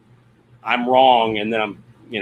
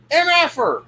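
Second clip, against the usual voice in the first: demonstrated yell. Shown as a demonstration. Demonstrating what he'll yell outside to Charlie, mock yell. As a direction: call it out high, as a pretend yell. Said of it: "walk outside and yell".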